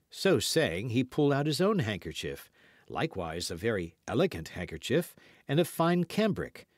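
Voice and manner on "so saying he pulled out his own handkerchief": ordinary delivery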